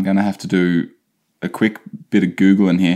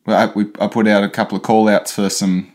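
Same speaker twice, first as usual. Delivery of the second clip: normal talking voice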